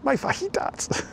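high, slow, breathless squeaky voice with a rise; quoted TV catchphrase. Retold or imitated. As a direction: squeak it like a cartoon character. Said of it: "what television program am I, uh, quoting from?".

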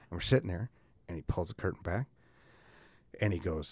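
normal talking voice

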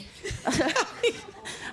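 breathy, silent burst of laughter; unvoiced laugh. Real, not acted. Laughing at her own story of the neighbour. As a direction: laugh without voice after the punchline.

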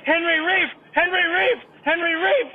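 very high, loud mock chant; insistent masseuse. Masseuse insisting to the confused client, high mock insistence. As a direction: repeat it urgently in a high voice. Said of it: "She's like".

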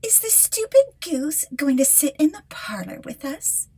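high, mocking stepsister voice; sneering stepsisters. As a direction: look down your nose at her, jeering. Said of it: "said they".

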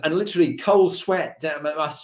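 ordinary talk, nothing marked

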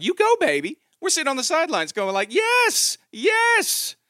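high excited cheering from the sidelines. Retold or imitated. Parents cheering daughter from the sidelines, excited and high.

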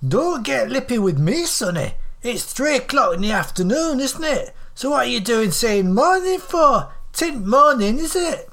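high-pitched, indignant northern scold; scolding old woman. Acted. Elderly friend pulls him up over saying morning, scolding.

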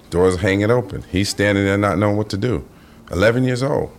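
ordinary talk, nothing marked

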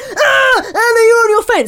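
high, loud accusing cry; mocking accusers. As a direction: call her out like the whole room. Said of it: "everybody literally turned on me and went".

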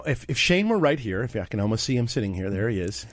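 ordinary delivery

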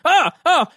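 high short yelps of alarm; mock startled yelps. Dewey yelping as Albert brandishes the fire torch, startled. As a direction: yelp twice at the flame.

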